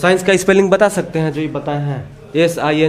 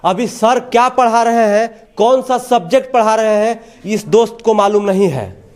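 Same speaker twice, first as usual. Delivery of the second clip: pitched-up, insistent reporting delivery; TV reporter's tirade; 4 semitones higher than usual. Real, not acted. Reporter blames officials for failing schools, in insistent report style. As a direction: hammer the complaint home to the viewers. Reporter's indignant piece to camera.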